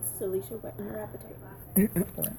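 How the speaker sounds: hushed, drawn-out sign-off; soft slow sign-off. Shown as a demonstration. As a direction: breathe the tagline slowly and softly. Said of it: "we should get to ten and say".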